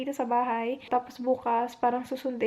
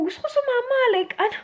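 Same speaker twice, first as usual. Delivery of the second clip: much higher and louder, fast and voice cracking into a cry; crying out she wants to go with her sick husband; tearful pleading; 8 semitones higher, 4 dB louder, faster than usual. Real, not acted. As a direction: plead to go along, near tears, words tumbling out. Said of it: "iyak ako na iyak".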